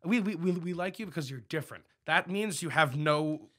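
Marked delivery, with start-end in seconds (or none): 0.0-1.8 high, sing-song fake sweetness; mock condescending praise. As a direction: gush it like a patronizing compliment. Mocking what people say.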